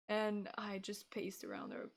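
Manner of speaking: ordinary delivery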